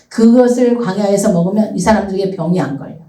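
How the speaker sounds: ordinary delivery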